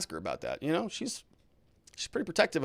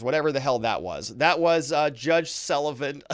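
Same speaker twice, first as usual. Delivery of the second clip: ordinary talk, nothing marked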